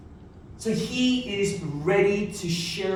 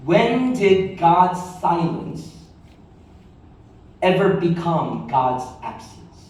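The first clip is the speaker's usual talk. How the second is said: slow emphatic question; 4 semitones lower, slower than usual. Shown as a demonstration. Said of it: "write this down".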